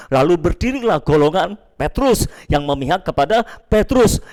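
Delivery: usual speaking voice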